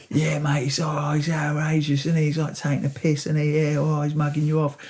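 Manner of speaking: lightly put-on Essex accent; casual Essex accent; proper Essex. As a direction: mimic a proper Essex lad, a hint of it. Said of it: "proper Essex".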